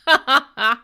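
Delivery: loud burst of real laughter; big hearty laugh. Real, not acted.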